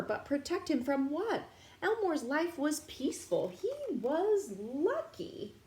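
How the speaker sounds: put-on lively read-aloud for children; storybook narration. A narrator's reading. Teacher reading a picture book to kids, lively. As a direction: read it brightly to young listeners.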